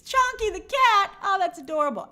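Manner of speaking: light up at the cute name; gushing over a cat called Chonky; delighted. Real, not acted.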